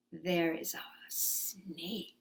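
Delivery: slow, spooky whisper. Acted. Teacher warning kids about the snake, whispering. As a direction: hush it like a danger. Story tension for children.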